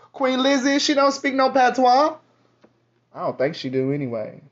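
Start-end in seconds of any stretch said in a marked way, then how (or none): 0.1-2.2 higher, excited mocking jab; mocking exclaim. Real, not acted. Mocking coworkers' queen claim, higher teasing. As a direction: toss it up high, teasing.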